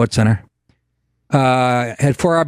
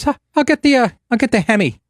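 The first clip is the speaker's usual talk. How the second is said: high, laid-back gruff neighbor impression; neighbor's voice; 9 semitones higher, faster than usual. Retelling the neighbor's offer, high mock neighbor voice. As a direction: drop into the hunter neighbor's voice.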